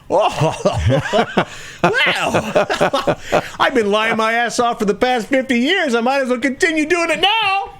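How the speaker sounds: high, laughing put-on old man voice; hammed-up old speaker, laughing; mock old politician.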